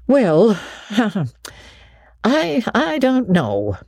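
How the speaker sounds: slow, hesitant and unsure; slowly. Acted. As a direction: draw the words out, hesitating. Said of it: "she said slowly".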